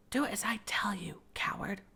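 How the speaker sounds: stern whispered order. Acted. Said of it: "could only hear whispers".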